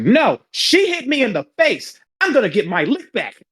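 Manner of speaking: outraged protest. Retold or imitated. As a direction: imitate an indignant girl demanding payback. Girl hit in face, protesting.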